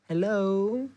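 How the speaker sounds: lightly imitated gentle operator's high, soft hello. Retelling the emergency operator answering him, mild high soft hello. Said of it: "C'est hyper douce".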